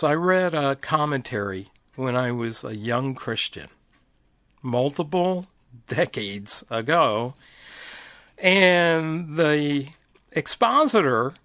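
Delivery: ordinary delivery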